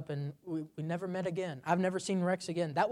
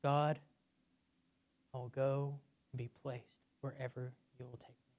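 softer, humble surrender to God; 3 semitones lower, 5 dB quieter than usual. Shown as a demonstration. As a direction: offer yourself quietly and meekly. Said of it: "It's from a place of humility".

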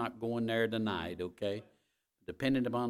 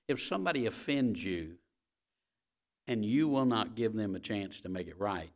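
ordinary talk

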